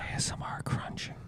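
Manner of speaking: hushed ASMR whisper; soft breathy-free whisper, close mic. Real, not acted. ASMR croissant tasting whisper.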